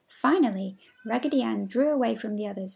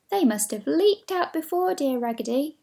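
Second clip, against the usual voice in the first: high, louder eager dolls; excited chorus; 5 semitones higher, 4 dB louder than usual. Acted. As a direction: cry out in happy agreement. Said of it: "cried all the other dolls".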